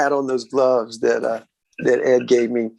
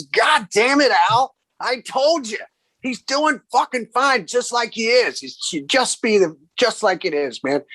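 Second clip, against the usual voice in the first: raised high, exasperated shout at his brother; angry outburst; 9 semitones higher than usual. Retold or imitated. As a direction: blurt it out annoyed, as if slamming a cigarette down. Eddie angrily arguing with Al.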